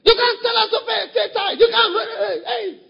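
high, loud mocking shout; hammed-up defiant yell; defiant member. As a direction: cry it out like a rebel who won't be ruled.

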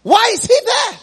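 high, loud indignant question; preacher crying out the rich man's question; anguished shout. Acted. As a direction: throw it out like a tormented man. Preacher echoes the rich man.

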